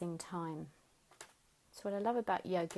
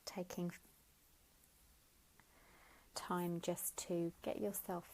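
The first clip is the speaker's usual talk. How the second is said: guided meditation. Acted. Teacher guiding listeners lying down, gentle guided meditation. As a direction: ease them into stillness, unhurried. Leads a Yoga Nidra relaxation.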